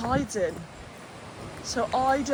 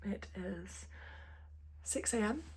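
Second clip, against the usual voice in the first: early morning whisper; 4 semitones lower, 17 dB quieter than usual. Real, not acted. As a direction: whisper so nobody wakes. Whispering at dawn.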